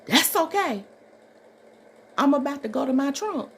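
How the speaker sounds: high, loud mock threat; quoting a man about to go to his trunk; a man's warning. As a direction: act out a man calmly threatening.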